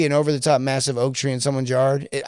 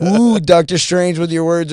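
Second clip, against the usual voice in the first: slow, louder, drawn-out mock wonder; mock awed wizard voice; 4 semitones higher, 8 dB louder, slower than usual. Customer mocking the salesman's slick words, slow mock awe. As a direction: tease him like a sorcerer of words. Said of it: "He's like".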